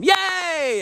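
squeaky, very high cheer; a yay way up high; mock crowd cheer. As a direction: cheer like the whole bar.

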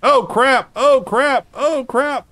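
panicked high yelling; being chased. Real, not acted. Enemies suddenly chasing ship.

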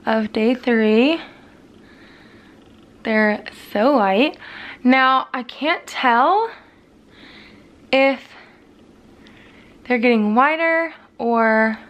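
talking with lips stretched back; lips pulled apart with fingers. Real, not acted. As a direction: lips held open showing teeth. Seen 4: Fingers stretch lips apart showing teeth throughout the labelled words.

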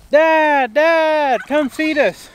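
high, loud whiny goat voice calling Dad; hungry goats' plea. Retold or imitated. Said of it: "They're like".

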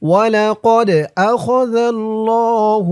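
Quran recitation. Shown as a demonstration. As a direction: intone it slowly like tajweed. Teacher recites verse.

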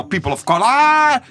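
loud, stretched final vowel rising high; exaggerated sing-song ending; drawn-out mock term. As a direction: draw it out in mock proclamation. Repeats the mocked term.

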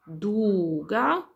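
slow rhythmic recital of note names; drawn-out, measured teaching demo; syllable by syllable. Shown as a demonstration. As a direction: recite each name slowly, one beat per syllable. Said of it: "Czyli jeszcze raz".

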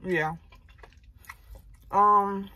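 talking while chewing; chewing food between words; mouth partly full. Real, not acted. Seen 0: bite at -5s, lips closed chewing through most words.

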